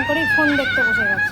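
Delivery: high, loud laughing outburst; laughing exclaim. Real, not acted. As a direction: burst out laughing as you call it out. Laughing at kid on phone.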